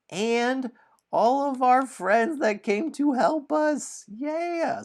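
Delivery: high, loud celebratory squeal; excited cheer. Pretend. Host shows off all the helpful friends, squealing cheer.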